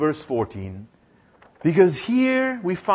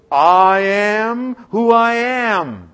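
slow, louder, solemn and grand; voice of God; 3 semitones higher, 6 dB louder, much slower than usual. Acted. God answering Moses, slow and solemn. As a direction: proclaim it slowly like a divine voice.

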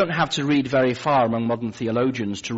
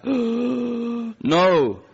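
higher, strained no after a gasp; 13 semitones higher, 4 dB louder than usual. Retold or imitated. Gasping patient refusing the nurse, breathless. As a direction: push the no out on a gasp. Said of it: "gasping for breath".

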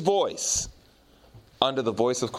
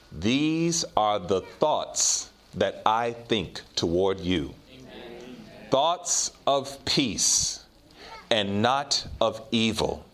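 slow scripture reading; 5 semitones lower, much slower than usual. Real, not acted. Reads scripture slowly, softly.

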